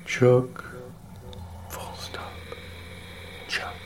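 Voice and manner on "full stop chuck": punctuation breathed in a whisper; whispered dictation. Acted. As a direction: whisper the punctuation marks aside. Reading punctuation aloud.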